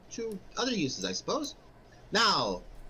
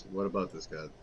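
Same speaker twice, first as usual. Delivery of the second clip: deep sly merchant aside; low voiced merchant; 12 semitones lower, 4 dB quieter than usual.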